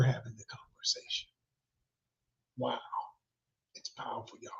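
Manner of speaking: soft breathy-free hush; whisper. Real, not acted. Describing the app having the conversation for you, whispered. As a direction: let it fall to a whisper. Trailing into hushed emphasis.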